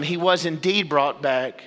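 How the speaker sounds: ordinary talk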